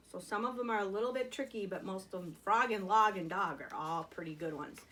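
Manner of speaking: normal talking voice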